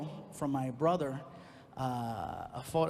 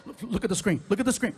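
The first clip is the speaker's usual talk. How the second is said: loud, higher, urgent repeated demand; agitated yelling; 4 semitones higher, 6 dB louder than usual. Retold or imitated. Man yelling for his girlfriend to see the email, agitated. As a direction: yell it insistently, tripping over the first word. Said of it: "I started yelling at the screen".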